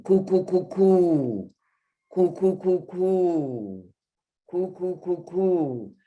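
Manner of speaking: "coo" repeated high with rounded lips; vowel drill. Shown as a demonstration. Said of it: "Round your lips".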